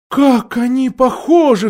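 high, drawn-out cry of amazement; queen's astonished exclamation; exclaiming. A narrator's reading. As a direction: marvel aloud, pitch lifted. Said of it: "вскричала королева".

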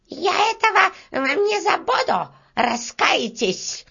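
very high squeaky threat; beetle crying out. Acted. Beetle threatens workers as she flees, shrieking thinly. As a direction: squeal it, dragging out the last word. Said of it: "крикнула".

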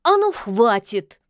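loud, slow cut-off command; cross, drawn-out order; angry. A narrator's reading. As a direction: put a firm stop to it. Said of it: "Тут уж и Яга осерчала".

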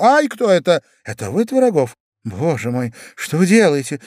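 high, startled lady's voice; yelp, then protest in alarm. Acted. Lady seized by an admirer in the street, startled alarm.

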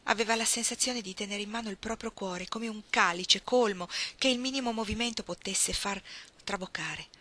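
usual speaking voice

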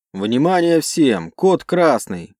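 drawn-out shouted alert; loud slow alarm call. Acted. Alarm called to whole crew.

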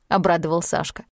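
usual speaking voice

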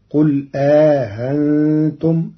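آهنتم drawn slowly, with a long alif and a softened second hamza; eased hamza with alif. Shown as a demonstration. Teacher demonstrates Qalun's reading with an inserted alif, slowly. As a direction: stretch the alif, then ease the hamza in "آهنتم". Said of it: "فتصير".

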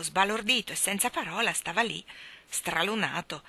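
ordinary delivery, nothing marked

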